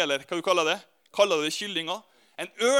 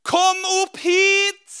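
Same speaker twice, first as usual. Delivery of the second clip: high, loud, slow call from far above; a mother eagle calling her son up to her; calling from the sky; 10 semitones higher, 6 dB louder, much slower than usual. Retold or imitated. Eagle mother calls down to Ørnulf, loud and high.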